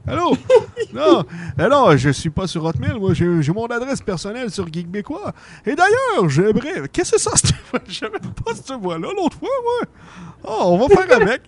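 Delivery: Santa voice jumping high and loud at moments; unsteady Santa Claus. Acted. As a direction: keep losing the character voice, with laughs. Santa loses and mocks his voice.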